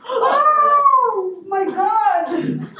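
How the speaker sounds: real amused shock. Real, not acted. Husband says yes to lice.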